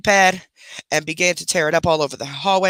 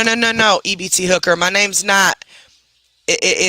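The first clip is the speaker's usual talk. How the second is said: ordinary delivery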